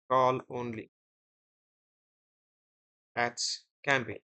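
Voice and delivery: usual speaking voice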